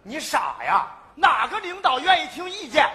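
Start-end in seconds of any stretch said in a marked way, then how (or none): 1.1-2.9 loud scoff. Acted. Scolding friend, laughing.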